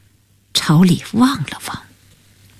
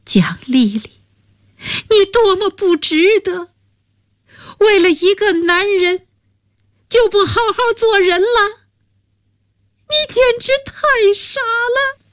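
much higher, voice strained by weeping; a friend crying over her friend's folly; tearful pleading; 13 semitones higher than usual. Acted. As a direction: plead with her through tears, pitch climbing. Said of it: "流着泪说".

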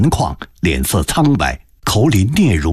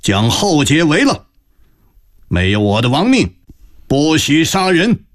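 called orders; 8 semitones higher than usual. Acted. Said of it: "朝外喊道".

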